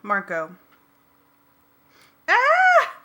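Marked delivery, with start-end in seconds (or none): 2.3-2.9 sudden high loud shriek; Cassie's terrified scream. A narrator's reading. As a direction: let out a full startled scream. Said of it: "Cassie screamed".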